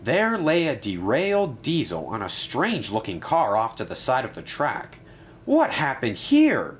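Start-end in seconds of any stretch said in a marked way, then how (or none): 5.4-6.8 high, louder, drawn-out question; shocked cry. A narrator's reading. Ryan finds a derailed Diesel, crying out in shock. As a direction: cry out slowly in alarm. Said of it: "cried Ryan".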